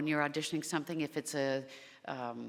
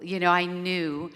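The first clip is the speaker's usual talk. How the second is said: usual speaking voice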